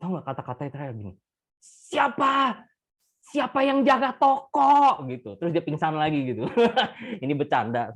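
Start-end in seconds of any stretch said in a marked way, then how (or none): 1.6-5.0 high and loud, slow and drawn out; the dying shopkeeper roaring about his store; angry shout. Acted. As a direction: demand to know who is minding the shop. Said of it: "suaranya makin keras".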